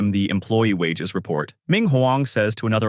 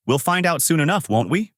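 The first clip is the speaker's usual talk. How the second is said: plain reading voice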